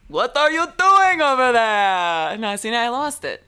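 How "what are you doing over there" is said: loud, high, slow drawn-out vampire call; vampire impression. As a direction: stretch out "doing" like a theatrical vampire. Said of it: "You sound like the Count from Sesame Street".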